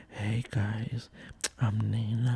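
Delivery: mock ASMR whisper; smooth-voiced wife. Husband imitates wife greeting listeners, in a soft whisper. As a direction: whisper a slinky podcast greeting. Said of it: "reviews she's been getting about her voice".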